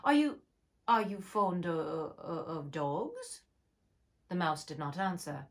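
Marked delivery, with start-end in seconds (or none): 0.0-3.4 nervous halting question; slow, faltering; stammering Alice. Acted. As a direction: stumble over it to change the subject. Alice stammering change of subject.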